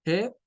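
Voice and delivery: "G" said as a short "heh"; HE syllable. Shown as a demonstration. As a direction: give "G" a clear E after the H. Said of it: "dos pronunciaciones para la primera sílaba".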